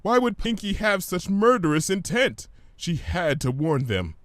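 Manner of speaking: ordinary delivery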